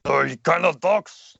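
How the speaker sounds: slow, heavy Russian accent from the throat; big Russian accent, like a hulking gunner. Shown as a demonstration. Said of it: "Heavy kind of talks from the throat".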